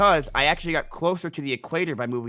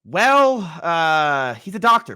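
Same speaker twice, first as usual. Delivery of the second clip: exasperated; 7 dB louder, much slower than usual. Real, not acted. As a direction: say it like it's obvious. Exasperated retort to friend.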